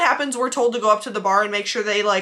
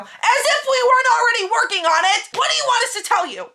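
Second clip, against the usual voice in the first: much higher, indignant rant; exasperated outburst; 12 semitones higher than usual. Acted. Hostess venting about the waiting customer, exasperated. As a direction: burst out in high-pitched exasperation.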